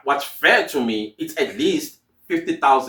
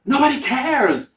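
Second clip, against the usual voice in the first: high exasperated; 12 semitones higher than usual. Real, not acted. Emphatic dismissal.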